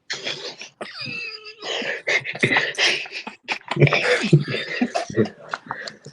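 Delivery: a long, high burst of laughter at the nickname. Real, not acted.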